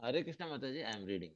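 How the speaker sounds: faint, far from the mic; barely audible. Real, not acted. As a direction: voice barely carrying. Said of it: "your voice is very low".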